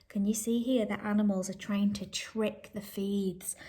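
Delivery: usual speaking voice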